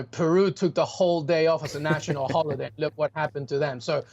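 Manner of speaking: normal talking voice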